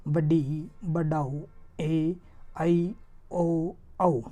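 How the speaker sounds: very slow, stretched vowels; teacher stretching long vowels; drawn-out vowels. Shown as a demonstration. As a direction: hold each vowel to show its length. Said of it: "इनकी संख्या सात होती है".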